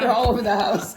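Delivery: loud laughing echo of the line. Real, not acted. Cohost echoing with a loud laugh.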